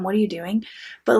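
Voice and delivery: usual speaking voice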